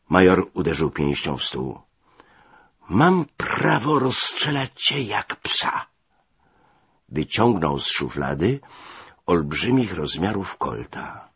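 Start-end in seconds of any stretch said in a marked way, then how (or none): 2.9-5.9 high, slow, strained voice; enraged threat. A narrator's reading. Major threatens to shoot his subordinate, enraged. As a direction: threaten slowly in raised rage.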